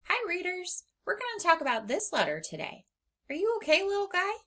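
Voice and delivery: high, sweet voice for a little letter; sweet puppet-talk. Acted. Teacher coos at the letter puppet, high and sweet. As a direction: coo at the little guy like a kid.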